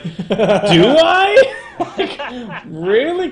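squeaky laughing question; high laughing mock surprise. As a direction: laugh it out, incredulous. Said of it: "And I was like".

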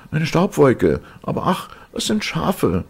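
high-pitched woman's voice calling from afar; sister calling back. A narrator's reading. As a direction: call down loudly in a very high voice, then disappointed. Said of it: "antwortete die Schwester".

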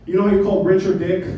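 ordinary talk

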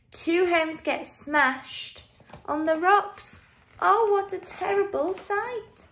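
high-pitched storybook horror; dramatic dismay. A narrator's reading. Narrator telling children of smashed homes, dramatic dismay.

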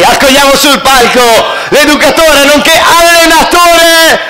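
slow, high stage announcement; announcer voice. Acted. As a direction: present the guest like a showman. Announcer introduces guest on stage. (annuncio da presentatore)

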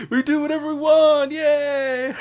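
shrieking mock cheer through laughter; mock patriotic cheer. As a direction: ham up a gleeful freedom shout. Mocking America, cheering.

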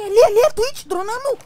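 voz aguda que cresce até um berro; dê o alarme subindo até gritar; alerta aos gritos. Real, not acted. Avisa que o drone apareceu. (alerta gritado, cada vez mais alto)

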